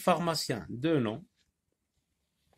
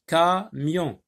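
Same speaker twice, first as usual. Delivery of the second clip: Camion raised and louder, split ca, mion; syllable by syllable; 5 semitones higher, 4 dB louder than usual. Shown as a demonstration. Teacher sounding out two syllables, clearly separated. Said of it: "deux syllabes".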